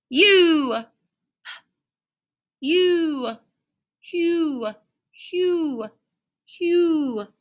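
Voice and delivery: "U" said as a long held high vowel; long U sound. Shown as a demonstration. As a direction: stretch out "U" like a name. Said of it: "we say".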